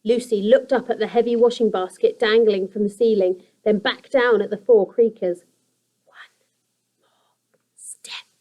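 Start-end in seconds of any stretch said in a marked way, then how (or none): 6.1-8.3 breathless whisper; whispered thought. A narrator's reading. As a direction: whisper it under your breath. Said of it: "Lucy thought".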